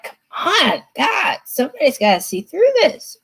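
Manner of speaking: high-pitched, pleading exasperation; exasperated plea. Acted. Client sitting there watching everyone believe the manipulator, exasperated. Acts out the frustrated listener's plea.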